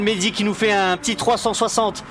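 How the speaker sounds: plus aigu, exalté, encourageant; commentateur enthousiaste. Real, not acted. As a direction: pousser le pilote avec ferveur. Commente la fin de course. (commentaire survolté en direct)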